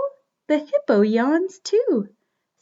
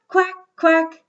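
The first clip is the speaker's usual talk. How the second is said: duck quacks; 3 semitones higher, 5 dB louder than usual. Pretend. Ducks in the song.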